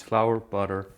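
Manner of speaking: ordinary delivery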